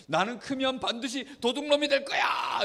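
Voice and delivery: high-pitched vow of a would-be thief; child's mock resolve. Child's bad resolve acted out. (아이처럼 높은 톤으로 다짐하듯)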